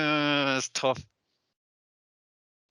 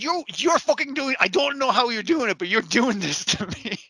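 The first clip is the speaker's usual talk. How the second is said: mock exasperated accusation; 7 semitones higher than usual. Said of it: "It's, it's like".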